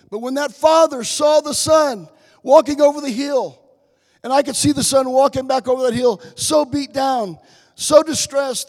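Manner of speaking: loud, high impassioned cry; full-voiced revival preaching; preaching at full force. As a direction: build to a fervent shout. Preaching climax over the hill.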